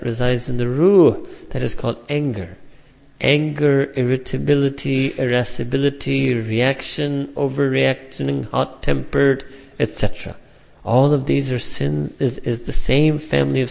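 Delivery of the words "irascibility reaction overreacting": ordinary delivery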